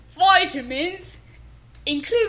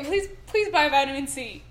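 normal talking voice

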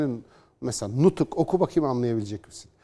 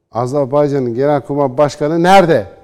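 louder, insistent repeat of the question; loud pointed question; 10 dB louder than usual. Real, not acted. Commentator demanding where the chief of staff is, loud and insistent. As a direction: press the question hard a second time.

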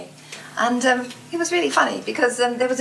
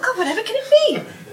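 eager curiosity; 12 semitones higher than usual. Retold or imitated. Her own eager thought.

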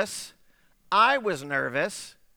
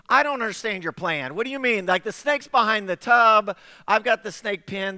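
louder, rising exasperation; exasperated protest; 5 semitones higher, 6 dB louder than usual. Retold or imitated. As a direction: argue that the plan makes no sense. Acted arguing over the plan.

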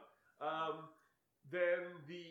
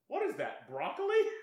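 "broccoli" jumps much higher and louder, shrieked as a question; mock incredulous; 12 semitones higher, 8 dB louder than usual. Roommate blurting at the girl's tattoo, mock-baffled shriek. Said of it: "he goes".

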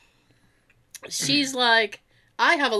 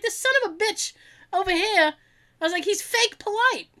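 high, indignant griping; retold tirade; 8 semitones higher than usual. Ranting about the man who held the door, indignant tirade. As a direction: gripe in a higher voice, drawing out the insult. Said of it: "I went on that same tirade".

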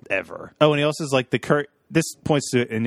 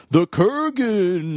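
drawn-out, mock-ominous villain announcement; dramatic reveal; 8 semitones higher, 4 dB louder, much slower than usual. Announcing who the dark figure is, dramatic reveal.

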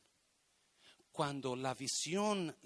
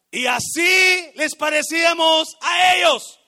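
slow, very loud and high; preaching shout; 13 semitones higher, 22 dB louder, slower than usual. Acted. Preacher crying out the spies' words at full force. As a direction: hammer each word out.

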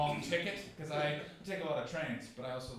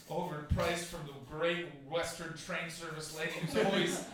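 ordinary talk

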